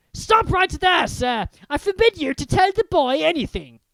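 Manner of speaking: a panicked uncle laying down the law; commanding. Acted. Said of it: "he commanded".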